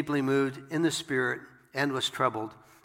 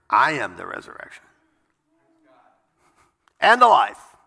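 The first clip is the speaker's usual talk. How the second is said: loud ringing proclamation, last words far louder; proclaiming; 2 semitones higher, 11 dB louder than usual. Acted. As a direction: declare it to the whole crowd. Jesus proclaims, voice rising loud.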